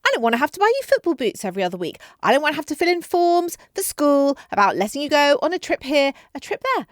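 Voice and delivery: loud, rising list of complaints; a mum ranting about what she does for her kids; exasperated rant. Retold or imitated. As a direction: vent every chore in one breath.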